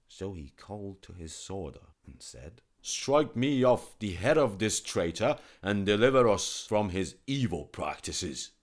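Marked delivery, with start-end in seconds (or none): 2.8-8.5 loud royal command; angry king. Acted. King ordering his sworder to behead the physician, loud. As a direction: order the headsman sharply.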